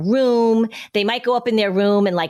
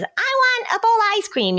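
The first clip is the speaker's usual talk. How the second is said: put-on much higher, childlike demand for ice cream; child demanding. Parent imitates a child asking for ice cream at lunch, high childish voice. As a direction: pipe up like a little kid who wants dessert. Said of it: "and your child says".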